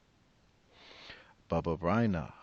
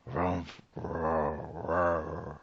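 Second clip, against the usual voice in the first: muttering bear growls; much slower than usual. Acted. Bear growling along with the blessings. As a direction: grumble like a bear. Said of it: "Old Bear muttered and growled".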